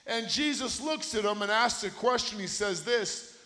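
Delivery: loud, high, impassioned preaching; fired-up sermon climax; full-force preaching. Acted. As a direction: preach it to the back row.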